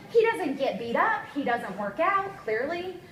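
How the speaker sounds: ordinary talk, nothing marked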